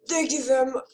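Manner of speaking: half-remembered British accent attempt. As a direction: try a British accent, unsure of it. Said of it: "I forgot my British".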